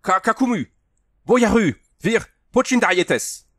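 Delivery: high, loud foreign challenge; hailing. A narrator's reading. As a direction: shout across the field at a stranger. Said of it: "Puis il héla Andrew".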